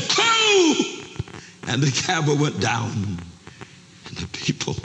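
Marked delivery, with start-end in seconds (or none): 0.1-1.1 very high, drawn-out angry cry; angry auctioneer. Acted. Angry auctioneer counting down to silent bidders, angry shout. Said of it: "He was angry now".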